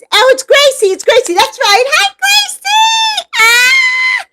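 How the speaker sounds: high, loud delighted greeting; excited squeal. Real, not acted. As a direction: squeal with joy at a friend.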